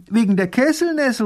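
high, loud interruption from a little duke; calling out. A narrator's reading. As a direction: cut in with a high cry. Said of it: "ruft der kleine Großherzog".

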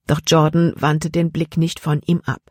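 plain reading voice

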